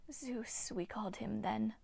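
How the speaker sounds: eerie breathy whisper of an ancient nymph; ghostly nymph whisper. Acted. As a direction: hiss it like a withered water spirit. Nymph Hagno speaks.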